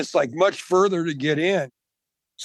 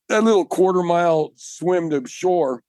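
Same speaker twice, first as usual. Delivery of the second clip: ordinary delivery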